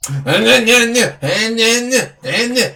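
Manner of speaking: mocking singsong nagging imitation; dismissive mimicry. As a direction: mimic a partner brushing you off with empty noises. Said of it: "she just be like".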